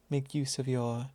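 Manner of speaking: ordinary talk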